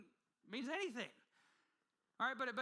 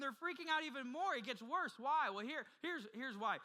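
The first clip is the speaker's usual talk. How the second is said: usual speaking voice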